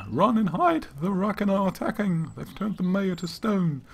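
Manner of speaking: high, loud yelled warning that slows at the end; yelling warning. Retold or imitated. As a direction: shout the alarm to everyone.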